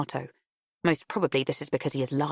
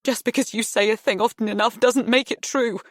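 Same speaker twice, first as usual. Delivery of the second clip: tearful yell; 5 semitones higher, 4 dB louder than usual. A narrator's reading. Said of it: "bursts into frustrated tears and yells".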